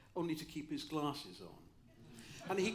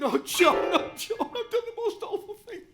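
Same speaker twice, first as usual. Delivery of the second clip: much higher, louder wailing confession; mock despair, pitched way up; hammed-up distress; 14 dB louder than usual. Young student confessing to his professor, hammed-up high wail.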